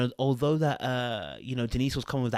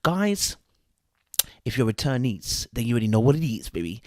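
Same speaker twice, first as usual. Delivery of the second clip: ordinary talk, nothing marked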